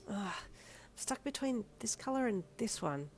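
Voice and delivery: slow, soft grumpy mumble. Acted. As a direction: grumble it over the colour cards. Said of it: "you mumbled grumpily".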